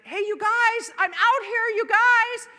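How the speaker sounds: high, loud calls for help; stranded on the rope, shouting for help; yelling out. Retold or imitated. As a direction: yell across to the group. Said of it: "I'm yelling out to them".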